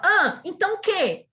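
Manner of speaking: loud, high, slow snapped retort; the lady retorting at the narrator, loud and angry; mock angry retort. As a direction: bark it back at her.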